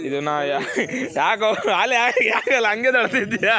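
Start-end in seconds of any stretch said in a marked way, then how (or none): 1.2-3.5 laughing tease. Real, not acted. Laughing at a friend's play.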